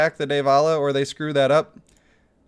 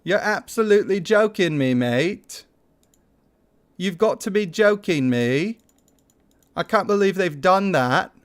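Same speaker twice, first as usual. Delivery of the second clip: put-on a loose, jokey British accent, a bit raised; mock British accent; 4 semitones higher than usual. Streamer mocks the broken event link in a mock British accent. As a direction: complain in a half-hearted British accent, like Jersey Shore. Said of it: "am I offending somebody by doing that accent".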